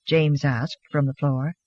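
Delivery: usual speaking voice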